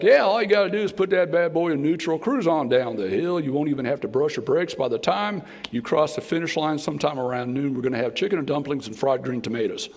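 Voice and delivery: fast, folksy hillbilly accent, played for laughs; a preacher joking in a country hillbilly accent. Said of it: "go back to my hillbilly roots".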